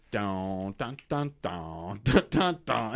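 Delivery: mock keyboard riff sung as "dun" syllables; imitating synth riff. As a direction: hum the preset beat like a cheap keyboard. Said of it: "and it goes".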